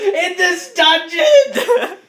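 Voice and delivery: high, loud, drawn-out cry; hammed-up high wail; mock wailing shout. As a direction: howl it slowly with a laugh.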